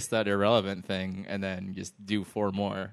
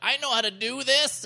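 overconfident rookie cop; 13 semitones higher than usual. Retold or imitated. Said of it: "And I'm like".

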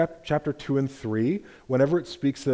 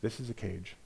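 much softer, low, a secret told close; hushed serpent voice; 2 semitones lower, 10 dB quieter than usual. Acted. Serpent leaning in close to Eve, much softer. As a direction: drop it to a confiding murmur. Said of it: "the Hajj leans in and he says".

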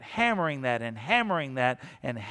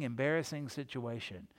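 usual speaking voice

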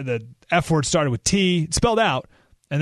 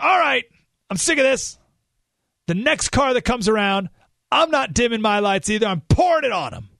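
raised, higher-pitched retold exasperation; fed-up outburst; 7 semitones higher than usual. MLK's brother fed up with blinding headlights, exasperated. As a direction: act out a driver who has had enough.

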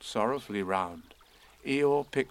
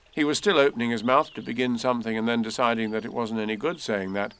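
ordinary delivery, nothing marked

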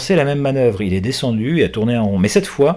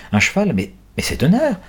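astonished; 5 semitones higher, 3 dB louder than usual. Acted. Wife sees horse arrive.

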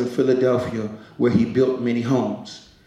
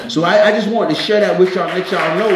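preaching; 6 semitones higher, faster than usual. Real, not acted. Preaching to congregation.

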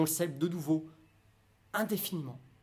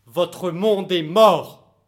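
slow shout; 2 semitones higher, 13 dB louder, much slower than usual. Acted. Climax of public speech.

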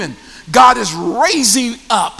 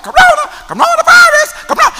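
high squeaky mocking pitch on corona; 5 dB louder, faster than usual. Shown as a demonstration. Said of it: "I want to laugh".

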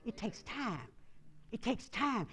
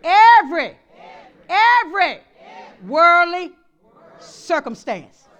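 louder, climbing every every every; call and response; 4 semitones higher, 15 dB louder, slower than usual. Shown as a demonstration. Pastor leading the church line, building loud. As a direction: build it up for them to echo.

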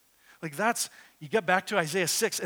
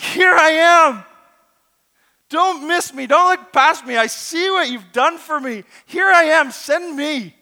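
high, full-voiced volunteering yell; eager exclamatory shout; 12 semitones higher, 16 dB louder than usual. Isaiah begging God to send him, shouting high and urgent. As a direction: beg at the top of your voice to be picked. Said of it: "capture the tone of what's being said".

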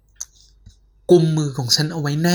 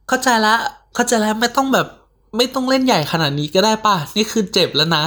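higher, dismissive teasing; scoffing friend; 4 semitones higher than usual. Acted. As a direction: brush it off as a silly prank. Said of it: "สเตฟฟี่กลับพ่นลมออกทางจมูก". (น้ำเสียงเยาะเย้ยไม่เชื่อ)

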